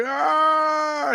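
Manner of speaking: long high mock scream; horror-victim wail, played for laughs; imitated scream. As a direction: hold a high acted shriek. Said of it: "He's, like, screaming".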